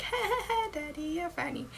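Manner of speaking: high mock giggling little-girl voice. Little girl teasing the dad chasing her, high mock little-girl voice. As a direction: put on a squeaky-high laughing toddler. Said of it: "and she's just like".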